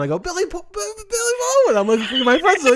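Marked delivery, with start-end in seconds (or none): 0.2-1.8 fumbling star-struck gushing; flustered stammer. Retold or imitated. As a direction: stumble over the words like a dazzled fan. Fan stammering the star's name.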